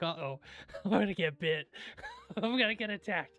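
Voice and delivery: retold alarm broken up by laughter; laughing mock worry. Man retelling meeting a big dog, laughing mock worry. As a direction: laugh through a pretend worry.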